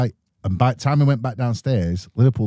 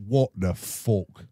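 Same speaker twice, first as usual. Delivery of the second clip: slow, dazed what the fuck; stunned; 2 semitones lower, 6 dB quieter, much slower than usual. Retold or imitated. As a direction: drag it out, bewildered. Woken by earthquake, stoned.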